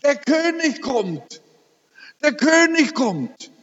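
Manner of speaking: dying wife seeing the King arrive, ecstatic. Retold or imitated. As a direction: cry it out in rapture. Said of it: "wiederholt sie es wieder".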